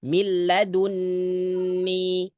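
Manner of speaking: slow, drawn-out Quranic recitation of Min ladunni; model recitation. Shown as a demonstration. Teacher models the verse for students, slow recitation. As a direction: chant Min ladunni slowly with the lam doubled. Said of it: "Ikutin saya".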